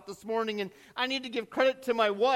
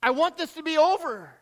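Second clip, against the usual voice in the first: high, loud lament; crying out; 6 semitones higher, 9 dB louder than usual. Acted. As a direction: call out to God, weary and pleading. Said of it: "where you cry out".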